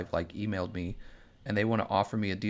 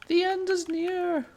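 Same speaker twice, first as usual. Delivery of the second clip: much higher, slow shrill cult-prophet voice; mock doomsday prophet; 3 dB louder, slower than usual. Streamer parodying climate warnings as a cult prophet, shrill and slow. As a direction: wail the prophecy in a ridiculous high voice. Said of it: "she was, like, freaking out".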